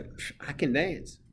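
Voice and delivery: scoff, then draw out the claim; playful drawn-out protest; dismissive retort. Acted. Teacher retorting to mocking students.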